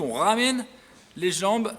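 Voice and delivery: ordinary talk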